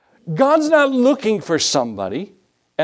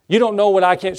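normal talking voice

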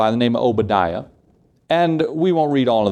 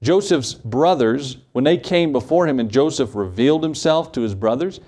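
ordinary delivery

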